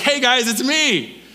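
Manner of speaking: high, drawn-out mock announcement; playful reveal. Jesus revealing himself at dinner, playfully imitated. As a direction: announce yourself like a prankster's surprise.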